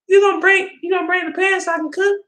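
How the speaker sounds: high put-on girlfriend voice, half yelled; imitating girlfriend. Girlfriend voiced asking for pan.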